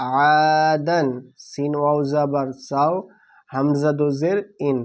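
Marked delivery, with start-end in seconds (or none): 0.1-1.1 আদান stretched long, vowels held; slow blended recitation. Shown as a demonstration. Teacher blends spelled letters into a word, drawn out. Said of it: "দাল দো জাবার দান".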